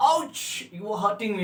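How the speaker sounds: light, mock-hurt ouch; mock pain. Shown as a demonstration. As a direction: yelp as your foot is stepped on. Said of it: "पैर, पैर पकड़ गया".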